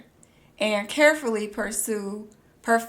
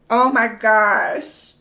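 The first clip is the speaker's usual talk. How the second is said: awed; 7 dB louder, slower than usual. Real, not acted. Awed at values.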